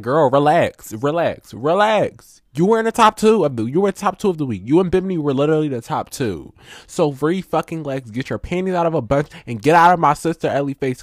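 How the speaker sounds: high, loud, sassy scolding; exasperated telling-off. Acted. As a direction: scold her like an exasperated friend. Mock telling-off of absent contestant.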